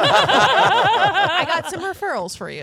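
slow, loud laughing tease. Real, not acted. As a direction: laugh while teasing. Teasing Bill about therapy.

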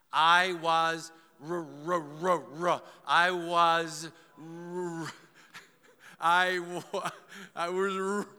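loud, strained stutter stuck on the R; mock stammering. Preacher acting out someone who can't admit fault, mock stammering. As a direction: pretend the word just won't come out. Acts unable to say "wrong.

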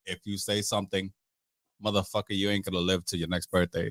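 low, quiet menace; threatening. Retold or imitated. Friend warning witness to keep quiet, low threat. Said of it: "Like that type of look".

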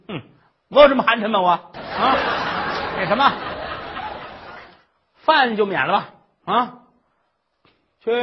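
loud, high nagging tirade; shrill scolding old mother. Acted. Mother-in-law scolds the crying daughter-in-law, loud and high.

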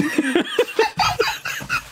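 high squeaky giggling; squeaky laughs. Real, not acted. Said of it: "doing the squeaky laughs".

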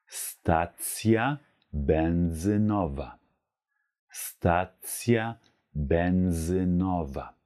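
Stacja benzynowa stretched slowly; very slow demo. Shown as a demonstration. Teacher slowly models stacja benzynowa. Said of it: "heißt auf Polnisch".

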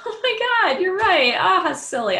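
high, loud, laughing burst of fake relief; mock sudden relief. Imagined listener pretending reassurance worked, hammed-up high laughing relief. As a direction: ham up the instant cure, giggling.

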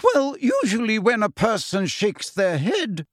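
icy, dry contempt; coldly dismissive teacher. Acted. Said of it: "said McGonagall coldly".

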